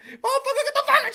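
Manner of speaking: high, quick cursing; high-pitched retold outburst; mock angry woman. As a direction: imitate her telling him off. Acts out woman cursing in his face.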